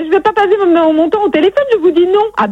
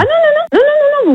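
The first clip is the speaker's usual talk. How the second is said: protestation aiguë; 10 semitones higher than usual. Real, not acted. Refuse la reprise des téléphones.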